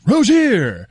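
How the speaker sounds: hammed-up sportscaster cry of "Rozier"; big mock announcer call on "Rozier"; Josie. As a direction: stretch "Rozier" out, leaping high on the end. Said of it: "pronounce it like Josie".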